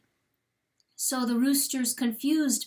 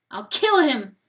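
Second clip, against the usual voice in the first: louder, hard threat; vow to kill; 3 semitones higher, 8 dB louder than usual. A narrator's reading. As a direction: spit out the vow. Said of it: "he said".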